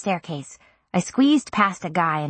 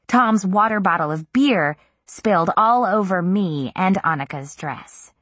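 usual speaking voice

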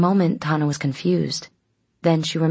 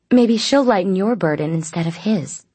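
higher, louder, sing-song needling; arch teasing; 5 semitones higher, 5 dB louder than usual. A narrator's reading. Midnight needling Gabriel about his burden, arch. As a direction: tease archly with a swooping lilt. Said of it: "Midnight said archly".

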